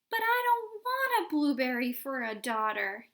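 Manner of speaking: high, loud indignant yell. A narrator's reading. Mother yelling at Mr Wonka in protest. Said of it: "yelled Missus Beauregard".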